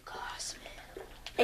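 whispered under breath; breathy whisper aside. Real, not acted. Mutters aside.

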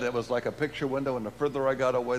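ordinary delivery

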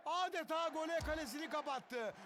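ordinary delivery

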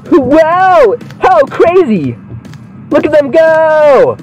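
high, drawn-out exclaiming; mock amazement. Gamer watching wrestlers, mock amazement high. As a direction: gawk like a hyped fan.

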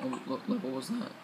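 voice crack. Real, not acted. Gamer losing count of the levels, voice cracking. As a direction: voice cracking on a quiet question. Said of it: "that voice crack".

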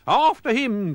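high, loud chase cry; pirates' cry. A narrator's reading. Pirates set off after the dog, high loud cry. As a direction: shout it like charging pirates. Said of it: "cried the pirates".

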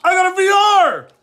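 loud high triumphant call; excited shout. Real, not acted. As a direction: crow about the lucky pull. Announcing his lucky pull.

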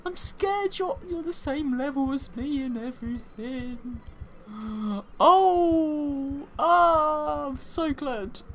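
high, loud, frantic gamer voice; panicked excitement. Real, not acted. Gamer panicking mid-battle, shrill and excited. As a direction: squeal through a scary battle.

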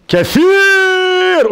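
كثير held very long, high and loud; stretched word. Shown as a demonstration. Preacher showing how abundant kathir is, stretched loud word. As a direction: draw out the last vowel of كثير in a high call.

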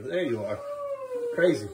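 ordinary talk

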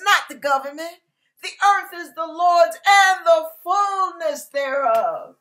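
loud, rhythmic preacher's refrain; full-force preaching. Acted. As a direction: drive each line home to the congregation.

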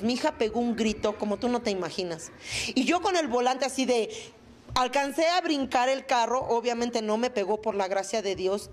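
ordinary talk

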